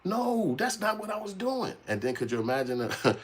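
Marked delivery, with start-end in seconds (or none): 0.0-1.7 much higher, offended; high defensive protest. Retold or imitated. Immigrants protesting the bad-friend claim, high and defensive. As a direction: deny it, hurt and defensive. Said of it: "looking at it like".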